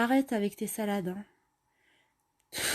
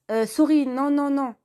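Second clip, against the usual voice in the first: flustered refusal; 7 semitones higher, 7 dB louder than usual. Acted. Alicia refuses drugs in toilets.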